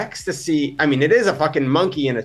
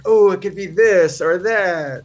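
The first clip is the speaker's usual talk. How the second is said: slow, drawn-out mock deliberation; imagined debating; 2 semitones higher, slower than usual. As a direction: act out weighing two options, slowly. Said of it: "like, debating about it".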